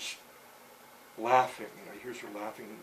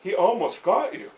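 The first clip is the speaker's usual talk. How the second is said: put-on excited girl; 8 semitones higher, 13 dB louder than usual. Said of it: "you know".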